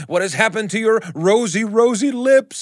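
high, rising panicky question; terrified. Acted. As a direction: voice climbing in terror. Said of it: "he asks, terrified".

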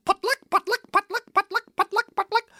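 very high, rattling bird chatter; hysterical guinea fowl squawk. Acted. Storyteller voicing barnyard guinea, hysterical squawking. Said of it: "The guinea was hysterical".

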